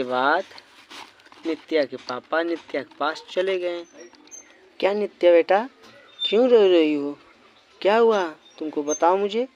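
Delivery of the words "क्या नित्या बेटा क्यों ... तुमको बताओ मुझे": louder voice, lightly acted concern; concerned father questioning. Father asks his crying daughter what happened, loudly concerned. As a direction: press the questions firmly and loudly.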